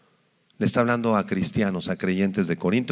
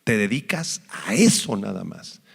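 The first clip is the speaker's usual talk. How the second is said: ordinary delivery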